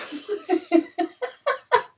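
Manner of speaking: a burst of high laughter at herself; high loud laugh. Real, not acted.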